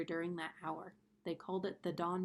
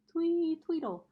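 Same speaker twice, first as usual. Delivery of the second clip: high, loud birdy chirp on twee; bright peeping chickadee twee tweedle; chirpy chickadee call; 10 semitones higher, 8 dB louder than usual. A narrator's reading. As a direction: chirp it like a little bird. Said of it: "their songs had sounded like".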